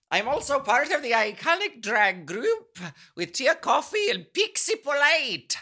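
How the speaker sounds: high, lilting put-on Welsh accent; hammed-up, unplaceable Welsh-ish British accent; mock Welsh accent. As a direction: read the letter in a sing-song attempt at Welsh. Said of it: "I don't know what that accent was, but it was pretty good".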